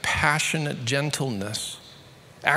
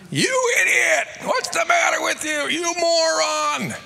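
much higher, louder furious insults; abusive yelling; 5 dB louder than usual. Retold or imitated. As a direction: scream at the useless apprentice. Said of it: "You're all going".

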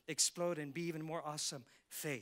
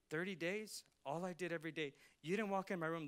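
ordinary delivery

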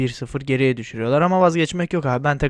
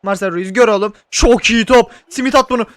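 excited shouting; 8 semitones higher, 7 dB louder than usual. Real, not acted. Shouting at players live.

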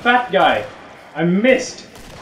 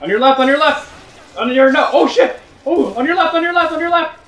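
high, loud repeated callouts; frantic warning; 12 semitones higher, 4 dB louder than usual. Real, not acted. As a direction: shout urgent warnings to a teammate. Frantic warnings to teammates in combat.

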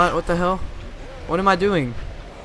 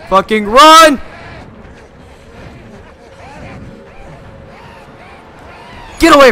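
loud, high frantic yell; raging gamer; 12 semitones higher, 11 dB louder than usual. Real, not acted. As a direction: bark at the chasing enemies. Fleeing enemies in game.

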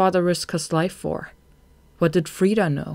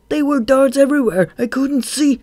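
high, loud, frantic excuse; defensive pleading; 10 semitones higher, 8 dB louder than usual. A narrator's reading. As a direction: protest wildly to the boss. Follower pleads his excuse.

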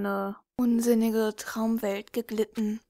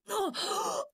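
sharp gasp; 11 semitones higher, 4 dB quieter than usual. Acted. Dramatic gasp mid sentence.

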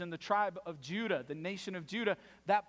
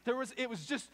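ordinary talk, nothing marked

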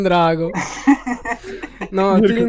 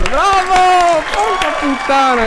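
high, loud thrilled cheer; excited cheering; 11 semitones higher, 7 dB louder, slower than usual. Real, not acted. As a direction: yell it out, blown away. Cheering after an amazing beatbox.

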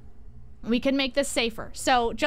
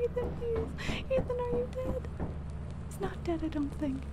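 much higher and softer, desperate begging; anxious pleading; 9 semitones higher, 8 dB quieter than usual. Real, not acted. Streamer begging her teammate to survive, high soft panicked pleading. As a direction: plead under your breath, high and tight. Waiting to see if Ethan died.

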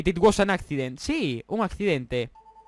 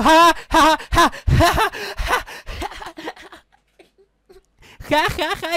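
high, loud cackling laughter; 9 semitones higher, 5 dB louder, much slower than usual. Real, not acted. Gamer laughing at the player he knocked off, high loud cackle.

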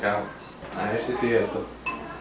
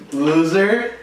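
teasing; 6 semitones higher, 6 dB louder than usual. Real, not acted. Teasing partner who lost challenge.

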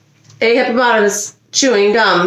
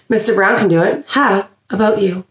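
ordinary delivery, nothing marked

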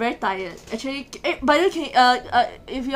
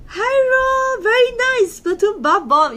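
excited cutesy greeting; 11 semitones higher, 5 dB louder than usual. Real, not acted. Greeting roommate in put-on voice.

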